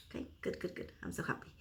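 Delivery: soft unvoiced whisper of relief; whispered joy. Real, not acted. Happy about her package, whispering to herself. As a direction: breathe it out, barely voiced and pleased.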